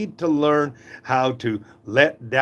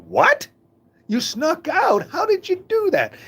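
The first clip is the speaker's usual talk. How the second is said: much higher, startled disbelief; shocked dad. Retold or imitated. Dad hearing his daughter snuck out, shocked and very high. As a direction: yelp it like the news just landed.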